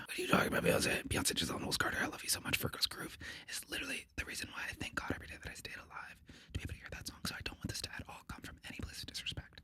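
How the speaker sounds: unvoiced, breathy whisper of adoration; hushed whisper. Podcaster whispering devotion to [name], hushed whisper. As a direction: whisper it like a secret confession.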